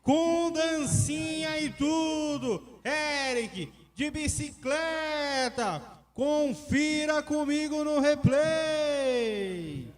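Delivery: high, stretched-out goal call; explosive goal commentary. Real, not acted. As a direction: hold the pitch up high, drawn out slowly. Goal call continues after goal.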